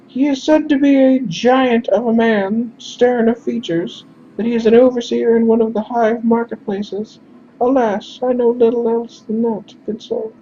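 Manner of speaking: courteous falsetto description; high-pitched character voice. Acted. Messenger describes the overseer to stranger, high-pitched voice. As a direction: describe him formally in a very high voice. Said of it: "we're just gonna do high-pitched instead".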